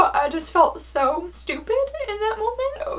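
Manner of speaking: high, tearful whisper; whispered crying. Real, not acted. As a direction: whispers through tears. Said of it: "Why am I crying?".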